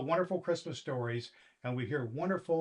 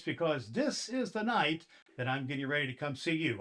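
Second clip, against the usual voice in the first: ordinary delivery, nothing marked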